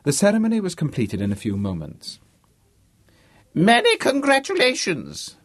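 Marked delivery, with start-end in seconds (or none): 3.5-5.4 high, loud bellow of a fake old don. James posing as the Vice-Chancellor, loud bellow. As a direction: boom it out grandly, pitched way up. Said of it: "bellowed James".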